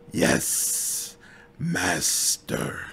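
soft hiss through clenched teeth; grudging whispered hiss of obedience; hissed through gritted teeth. Acted. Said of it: "through gritted teeth, she hisses".

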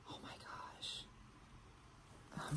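very soft, overwhelmed whisper; awed whisper. Real, not acted. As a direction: breathe it out in wonder. Moved by the gifted dress.

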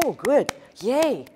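mock-thrilled little cheer, pitch swooping up; mock delight. Preacher mimics God jumping at our little offering, mock delight. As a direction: gush it like a delighted cheer, mocking. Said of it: "he's jumping on and going".

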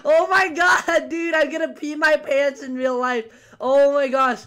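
high, loud cry easing slightly; scared, high-pitched outburst; startled panic. Real, not acted. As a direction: shout it straight after a huge scare. Badly startled in game.

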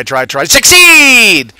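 hammed-up victory cry, stretched long; triumphant yell. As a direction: build to a high, loud, drawn-out SUCCEED. Hammed-up motto after Yoda quote.

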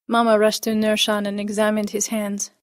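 usual speaking voice

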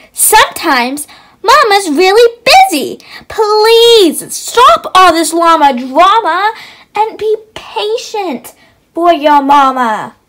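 loud, firm mother; scolding mama. Acted. Mama Llama scolding baby llama, loud and firm. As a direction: tell him off, loud and firm.

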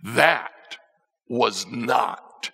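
ordinary talk, nothing marked

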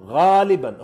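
loud punched غالبًا; emphatic mostly. Real, not acted. Coach announcing a rule to listeners, loud stress. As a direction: hit غالبًا hard and loud.

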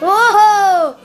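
loud high "Oho!" whoop; loud surprised whoop; excited whoop. Real, not acted. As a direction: whoop at the wild driving. Excited yell at car chaos.

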